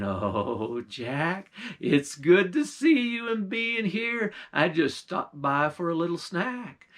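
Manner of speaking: slow, sugary witch; sly witch voice. Acted. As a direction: sweet-talk him, slowly. Witch sweet-talking Jack.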